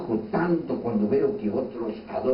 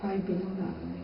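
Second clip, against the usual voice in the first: casual mock apology; 7 semitones higher, 6 dB quieter, slower than usual. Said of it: "y nosotros".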